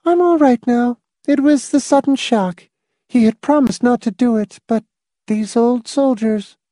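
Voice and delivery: high, level voice set apart from narration; high character voice. Acted. A character explaining away the sudden shock, high voice. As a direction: hold the character's pitch far up.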